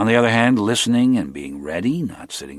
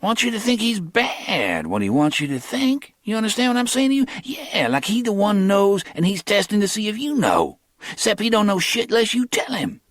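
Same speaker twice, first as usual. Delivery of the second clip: higher, quick street talk; lightly voiced young hustler; Cornell's voice; 14 semitones higher than usual.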